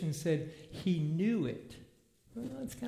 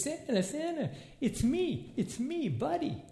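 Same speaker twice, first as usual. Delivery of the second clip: put-on much higher, eager childlike calls; excited Buddy; 10 semitones higher than usual. Buddy calling to Santa at the mall, higher and overjoyed. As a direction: bounce with glee at seeing Santa.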